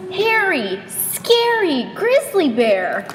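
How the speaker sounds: "hairy, scary grizzly bear" slow, each word stretched; slow, spooky emphasis on "grizzly"; drawn out for effect. A narrator's reading. Narrator dramatizes scary bear.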